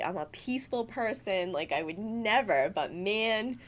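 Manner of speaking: usual speaking voice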